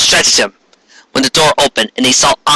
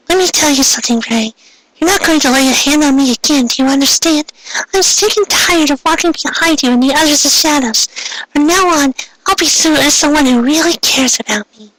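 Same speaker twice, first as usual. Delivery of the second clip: put-on much higher girl's voice, bitter and defiant; furious Ami; 14 semitones higher than usual. Ami accepting the villain's offer, high defiant girl voice. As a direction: spit out her acceptance in a high girlish voice.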